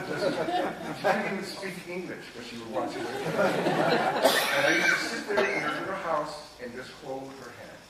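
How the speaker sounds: talking through a real laugh, slowed at first; laughing as he remembers her; laughing fondly. Real, not acted. Son laughs recalling his mother watching American Idol, laughing.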